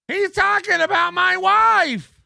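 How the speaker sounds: high, loud indignant mock yell; mock offended streamer. Streamer's mock outrage at the comic, high yell.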